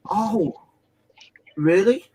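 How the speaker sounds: high, breathy whispered "Oh, really?"; mock astonished shop assistant; surprised gasp. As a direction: act caught off guard, voice jumping up.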